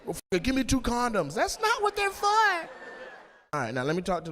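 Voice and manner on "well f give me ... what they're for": high-pitched exasperated squawk; hammed-up high panicky protest; mock frantic. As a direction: squeal it in comic panic. Acted frantic headphone scene during sex.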